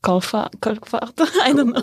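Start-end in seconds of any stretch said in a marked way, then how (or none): 0.6-1.1 "Colfort" in a French accent, trailing into a laugh. Shown as a demonstration. Asked to say the town name, French accent. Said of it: "Then say it in the French accent".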